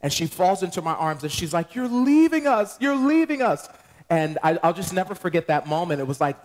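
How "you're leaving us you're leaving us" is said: mock weeping wail. Sister sobbing into his arms, mock wail. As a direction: ham up a tearful wail, much higher. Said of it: "she's crying and weeping".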